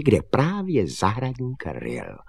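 ordinary delivery

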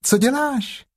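small high timid piglet voice. A narrator's reading. Piglet asking Pooh about the animal, high and timid. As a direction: squeak it out like a tiny nervous piglet.

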